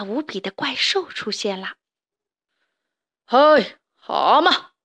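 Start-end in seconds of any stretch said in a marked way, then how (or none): 3.2-4.7 louder mock monster bellow; monster roar. Giant monster calls to the toads, loud mock roar. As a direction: boom it out like a huge beast. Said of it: "怪兽咆哮道".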